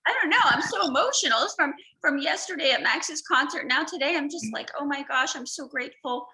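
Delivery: high, loud, choked-up gush of gratitude; fighting tears while talking to her friend; holding back tears. Real, not acted. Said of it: "I'm, like, trying not to cry".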